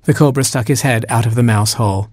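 ordinary delivery

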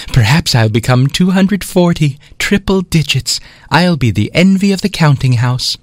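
high, bubbly daydreaming; giddy pride. Acted. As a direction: swoon over becoming huge. 24 gleefully imagines growth.

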